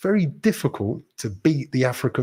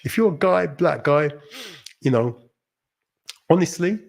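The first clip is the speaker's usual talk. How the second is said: ordinary delivery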